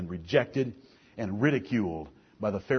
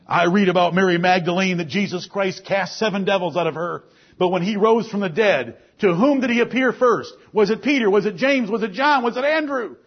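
higher, louder preaching rising through the list; preaching at full force; 8 semitones higher, 6 dB louder than usual. Acted. Preacher rousing the congregation with saved sinners, full force. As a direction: preach it out, each name hitting the congregation. Preacher listing apostles, demoniac.

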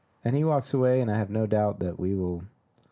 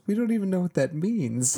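a raised, puzzled angel voice, lightly voiced; mock baffled angel; 8 semitones higher than usual. Podcaster quoting the angel to the vampire, mock puzzled. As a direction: play the clueless angel who has never heard the word. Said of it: "the other one just goes".

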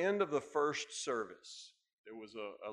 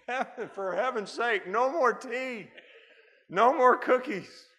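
high, loud mock plea through laughter; laughing protest; 12 semitones higher, 11 dB louder than usual. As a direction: beg off more tea while laughing, much higher and louder. Laughing, refusing more tea.

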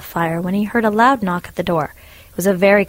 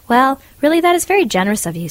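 eager thanks; 8 semitones higher, 4 dB louder, faster than usual. Acted. Hans thanking the miller.

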